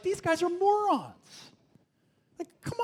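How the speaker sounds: high, slow drawn-out scorn; scoffing. Retold or imitated. Young reader scoffing at the Israelites, high slow scorn. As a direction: stretch it out in disbelief. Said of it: "I'm just like".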